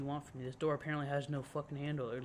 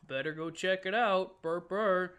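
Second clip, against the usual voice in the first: higher, louder mock eagerness; mocking imitation; 4 semitones higher, 6 dB louder than usual. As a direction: parody a clueless horror-film victim. Mocks horror-film character's foolish decision.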